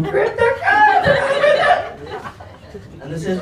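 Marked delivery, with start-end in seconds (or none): 0.0-1.8 high, loud, drawn-out taunt; mock jeering laugh. Mimicking his mates jeering at him with a laugh. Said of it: "They all started laughing, going".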